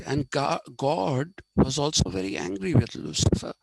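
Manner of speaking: ordinary talk, nothing marked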